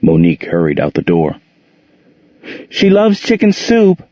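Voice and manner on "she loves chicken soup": much higher, called back over her shoulder; mother calling to Jordan as she leaves. A narrator's reading. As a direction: call it out while heading out the door. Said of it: "she called to Jordan".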